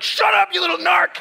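high, loud hammed-up snap at a little brother; mock angry snap. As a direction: shut the little narc up, playfully. Snapping back at a sibling.

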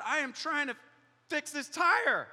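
high, loud exasperated outburst; hammed-up fury, dragging out the last words; mock angry. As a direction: build to a slow, shouted protest. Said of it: "I'm so angry".

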